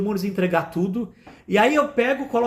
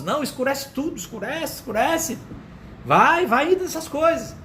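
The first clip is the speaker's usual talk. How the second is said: mock ordering leader; 5 semitones higher than usual. Preacher mimics leaders asking for the lights down, mock urging.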